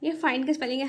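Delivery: normal talking voice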